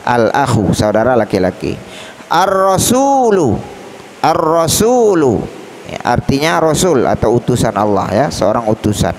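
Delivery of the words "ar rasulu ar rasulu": slow "Ar-rasulu", doubled rolled r and long u; al not read. Shown as a demonstration. Teacher models the example twice, "Ar-rasulu" without l. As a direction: hold the r and stretch su in "Ar-rasulu". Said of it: "dibaca dengan cara bacaan ar-rasulu".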